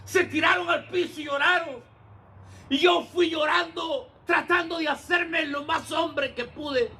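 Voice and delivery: high, slow, shouted preaching; fervent shouted sermon; preaching at full force. Acted. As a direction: build to a shouted climax of thanks. Preaching the man's homecoming.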